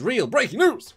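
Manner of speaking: loud, hyped announcement; excited. Real, not acted. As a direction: sell the breaking news. Hyping the breaking news.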